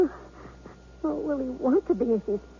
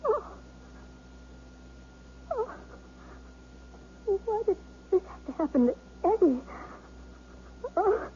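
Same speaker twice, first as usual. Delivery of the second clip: crying, voice pitched up and breaking; tearful; 8 semitones higher, 4 dB quieter than usual. Acted. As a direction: she breaks down into tears over her husband. Wife hears husband may be paralyzed.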